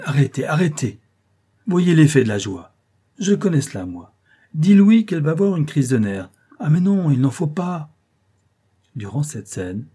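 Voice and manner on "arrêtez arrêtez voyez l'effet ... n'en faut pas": high, loud alarmed protest; agitated. A narrator's reading. Young nobleman anxiously halting the couturier, high and loud. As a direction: hurry to stop the fuss.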